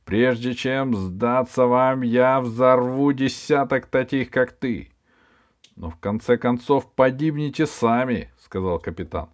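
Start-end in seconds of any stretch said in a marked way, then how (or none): 0.1-8.3 barbarian. Acted. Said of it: "the barbarian defies the captain".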